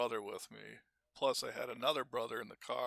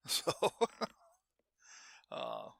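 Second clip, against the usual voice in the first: laughing; 6 semitones higher than usual. Real, not acted. Laughs after shotgun joke.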